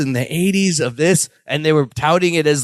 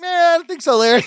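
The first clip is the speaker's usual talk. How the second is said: laughing; 11 semitones higher, faster than usual. Retold or imitated. Jennifer refusing, laughing.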